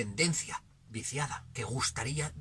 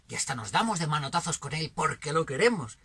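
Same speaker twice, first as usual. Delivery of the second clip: usual speaking voice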